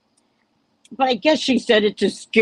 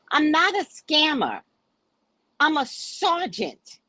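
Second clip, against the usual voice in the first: slow, high, emphatic mock protest; indignant client; 8 semitones higher, slower than usual. Client denying scamming and claiming rank, slow and indignant. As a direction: draw it out high with mock importance.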